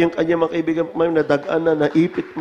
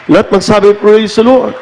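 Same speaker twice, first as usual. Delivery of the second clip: louder, higher cry of praise; rousing praise call; 6 semitones higher, 15 dB louder than usual. Acted. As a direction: lift the crowd into praise. Congregation called to praise.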